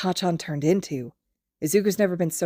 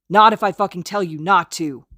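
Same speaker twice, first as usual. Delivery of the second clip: angry shout; 3 semitones higher, 8 dB louder than usual. Acted. Kacchan shaking Izuku.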